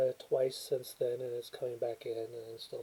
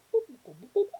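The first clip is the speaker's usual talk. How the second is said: calculator beeps; 12 semitones higher, 9 dB louder, faster than usual. Pretend. Tapping a calculator.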